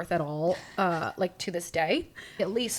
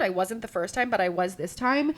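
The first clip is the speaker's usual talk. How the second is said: ordinary delivery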